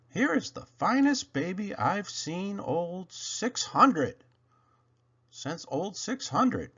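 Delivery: lightly voiced old engineer; a hint of a proud old engineer; proud old engineer admiring a new engine; Bill.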